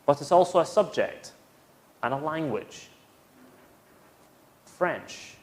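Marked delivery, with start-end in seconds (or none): 4.6-5.3 French as one clear syllable with a falling tune; model pronunciation. Shown as a demonstration. Teacher modelling French for students to repeat. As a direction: model French carefully for repetition. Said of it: "Say after me".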